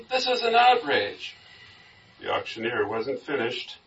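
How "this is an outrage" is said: very high, loud, slow protest; scandalized crowd member, acted out; outraged cry. A narrator's reading. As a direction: draw out the indignation at a high pitch. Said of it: "cried many".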